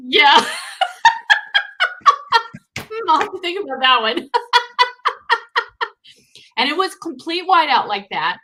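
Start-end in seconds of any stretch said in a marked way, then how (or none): 0.5-3.0 loud, high burst of laughter; high-pitched belly laugh; big laugh. Real, not acted. Joke about consensual sex. 4.3-5.9 high, rolling laugh; high cackling laughter. Real, not acted. Still laughing at the joke.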